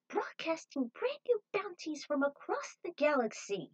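high-pitched TV co-host. Acted. Co-host cheering the bounty show's return to viewers, high and peppy.

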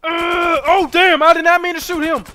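high, loud panicked protest; startled yelp. Real, not acted. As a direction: blurt it in shock. Accidental shooting in game.

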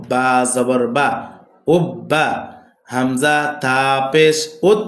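slow, measured syllable-by-syllable recitation; spelling drill. Shown as a demonstration. Teacher modelling Arabic letter spelling for students, measured drill recitation. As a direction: recite it as a steady drill, sounds drawn out.

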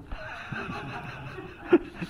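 a breathy laugh at the punchline; amused laugh. Real, not acted. Laughing at his teacher's trick, amused laugh. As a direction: laugh at the story's ending.

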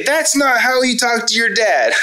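hammed-up fatherly scolding; mock angry dad scream. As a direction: play the mad dad yelling back, tongue in cheek. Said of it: "scream back and say".

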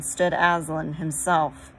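usual speaking voice

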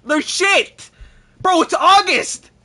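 high, loud furious outburst, drawn out; fed-up gamer shouting at the screen; angry shout. Real, not acted. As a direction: yell it in disbelief at the team. Raging at bad squad.

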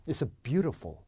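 It's beautiful as a native English "BYU-ruh-fol"; native English accent. Shown as a demonstration. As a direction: native English accent, middle vowel swallowed. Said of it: "원어민 같죠?".

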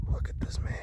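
hushed whisper about a friend. Pretend. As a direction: whisper it so he won't hear. Same words repeated whispered.

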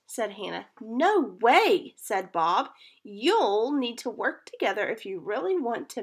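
put-on reading to children. A narrator's reading. Teacher reading a kite story to her class, lively storytelling. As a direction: read it out to the class like a picture book.

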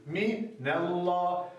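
"Minallah" very slow, with a thick, velar L; correct Quran recitation. Shown as a demonstration. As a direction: recite "Minallah" slowly, with a heavy L. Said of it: "Lamı kalın okudum farkındaysanız".

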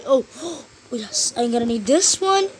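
ordinary talk, nothing marked

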